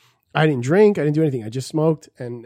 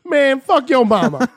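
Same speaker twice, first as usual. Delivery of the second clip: loud, slow, high, laughing; tough street guy; 10 semitones higher, 5 dB louder, slower than usual. Pretend. Imagined street insult.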